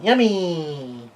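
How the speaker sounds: high, drawn-out cry of joy; yelled delight. A narrator's reading. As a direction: squeal it and let the pitch slide down. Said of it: "she yells out".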